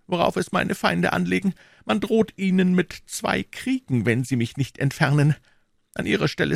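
high, lightly voiced sly cardinal; a scheming cardinal feigning humility; cardinal's voice. A narrator's reading.

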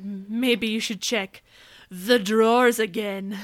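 slow, drawn-out mock suggestion; imitated prompting. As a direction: draw out the sly hint in imitation. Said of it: "Rex keeps whispering".

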